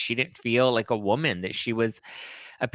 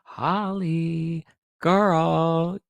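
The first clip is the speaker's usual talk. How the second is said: higher and louder, drawn-out despairing call; exasperated scolding; 6 semitones higher, 5 dB louder, much slower than usual. Real, not acted. Vlogger calling out Holly in exasperated disbelief. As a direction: shake your head and call her name in disbelief. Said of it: "I was just like".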